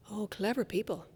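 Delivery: ordinary talk, nothing marked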